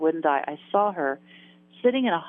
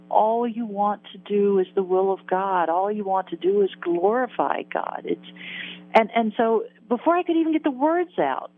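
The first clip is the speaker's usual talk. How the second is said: ordinary delivery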